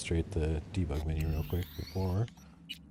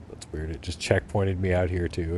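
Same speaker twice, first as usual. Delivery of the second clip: normal talking voice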